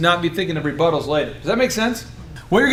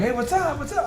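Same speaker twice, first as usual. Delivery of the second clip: high chirpy greeting; 8 semitones higher, 6 dB quieter than usual. Retold or imitated. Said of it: "people are like".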